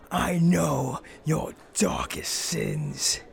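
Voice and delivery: slow, hushed vigilante threat; hammed-up avenger's rasping whisper; menacing whisper. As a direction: draw each phrase out with dark menace. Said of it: "I whisper".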